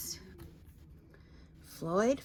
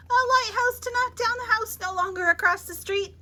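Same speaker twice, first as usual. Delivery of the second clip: building frenzy; 7 semitones higher, 5 dB louder than usual. A narrator's reading. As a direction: pitch and volume climb higher and louder. Cumulative list climbs.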